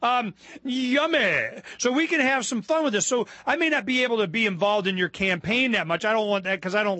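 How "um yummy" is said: slow, drawn-out relish; slow gleeful relish; savoring yummy. Real, not acted. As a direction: taste the idea. Relishing campaign mischief.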